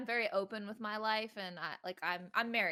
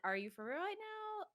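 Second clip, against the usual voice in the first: high-pitched, softly incredulous question; imitated disbelief; 10 semitones higher, 8 dB quieter than usual. Viewer doubting if the streamer is genuine, imitated disbelief. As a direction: quote a doubter, voice lifting high. Quoting a sceptical viewer's reaction.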